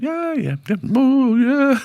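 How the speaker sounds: hammed-up squeal of delight; mock overexcitement. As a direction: act out an overjoyed acceptance. Retelling his thrilled reply to offer.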